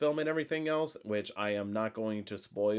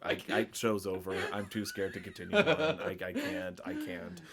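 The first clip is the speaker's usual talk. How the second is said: laughing; 2 semitones lower than usual. Acted. Jokes he is too scared.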